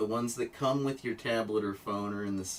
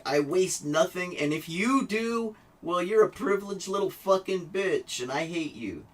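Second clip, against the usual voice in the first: higher-pitched mock tirade; vehement tone; 7 semitones higher, 4 dB louder than usual. Shown as a demonstration. Vlogger showing viewers his tone against wasters, vehement. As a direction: demonstrate an adamant, scolding tone. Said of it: "hear the tone of my voice".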